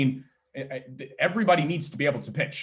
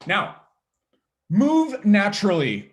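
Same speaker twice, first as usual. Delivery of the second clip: ordinary talk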